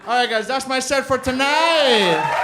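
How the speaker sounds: high, loud, slow final word; shouted sign-off. Real, not acted. Comic closes set to cheering crowd, shouted. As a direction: holler it out to the crowd, ending stretched.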